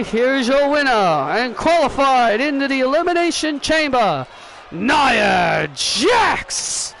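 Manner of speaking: victory announcement with drawn-out name; ring announcer. Pretend. Announcer declares Nia qualified for Elimination Chamber, ring announcer. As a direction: declare the winner, building to the name.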